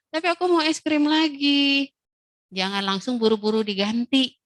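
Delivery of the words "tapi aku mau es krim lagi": put-on higher child's voice pleading for more; child begging, higher; whiny child. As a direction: plead like a little kid. Child whining for more ice cream.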